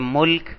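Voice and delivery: ملک said mulk, u after m, no vowel between l and k; pronounced mulk. Shown as a demonstration. Teacher showing students the reading mulk. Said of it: "کبھی اس کو کہتے ہیں".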